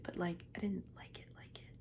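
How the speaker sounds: soft whispered confession; hushed aside. Real, not acted. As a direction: whisper the admission. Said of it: "Why am I whispering?".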